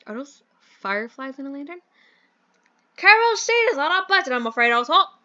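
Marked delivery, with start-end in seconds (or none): 3.0-5.2 high, loud stagey British gent; bad British accent. Mentor explains the electricity, over-the-top British accent. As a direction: explain like a hammed-up British chap. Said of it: "do the worst British accent".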